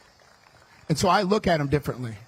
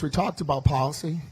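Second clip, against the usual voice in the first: ordinary talk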